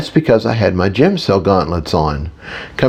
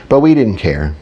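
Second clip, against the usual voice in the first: ordinary delivery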